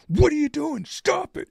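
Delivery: high, loud put-on Swedish accent, scolding. Retelling the Swedish boat buyer scolding him, Swedish accent. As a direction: imitate the Swedish buyer snapping in his accent. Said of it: "in his Swedish accent".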